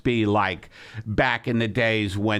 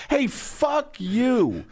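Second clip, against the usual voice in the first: slow, higher, drawn-out "Hey, fuck you"; lazy pioneer telling someone off; slow hammed-up brush-off; mock settler tweet; 11 semitones higher, much slower than usual.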